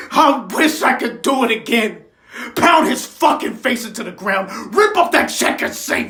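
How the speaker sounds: loud, forceful outburst; vengeful anger. Acted. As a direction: drive each blow home with your voice. Vengeful wish bursts out.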